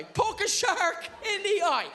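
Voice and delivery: high, slow laughing outrage; laughing disbelief. Real, not acted. Outraged at the silly advice.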